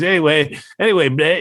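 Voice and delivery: mock nasal singer voice; holding nose. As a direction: talk with your nose held shut. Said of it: "He's holding his nose and he says".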